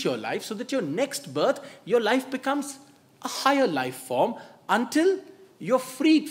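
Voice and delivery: a monk scolding a badly behaved snake; mock angry scolding. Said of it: "The monk got very angry and s-, just scolded the snake".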